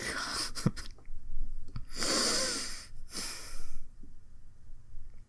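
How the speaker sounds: helpless airy laughter, high; breathless wheezing laugh. Real, not acted. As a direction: crack up, wheezing. Breaks down laughing mid-line.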